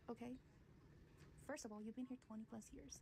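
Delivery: normal talking voice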